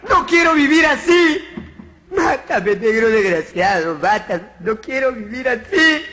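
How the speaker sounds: agudo y fuerte, con llanto fingido; súplica llorosa. Acted. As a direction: lloriquear exagerado pidiendo la muerte. Mono suplica llorando. (el mono sin rabo ruega al negro, llorando agudo)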